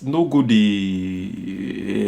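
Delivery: very slow, drawn-out words; slow demo. Shown as a demonstration. Coach warning candidates, very slow and drawn out. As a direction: stretch each word right out. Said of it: "You get".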